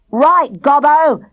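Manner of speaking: loud angry shout; gruff bully shouting across the field. A narrator's reading. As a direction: yell it at him, threatening. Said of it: "shouted Thrasher".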